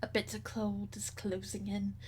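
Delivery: soft whispered begging for mercy; whispered plea. Acted. Wanderers begging Your Grace for shelter, in a whisper. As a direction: hush it out like frightened refugees.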